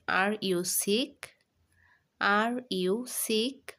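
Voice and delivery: very slow, word by word, for writing down; slow dictation. Shown as a demonstration. Teacher dictating to pupils, very slow and deliberate. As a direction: spell it out slowly so pupils can write. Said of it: "তাহলে এখানে আমরা বলতে পারি".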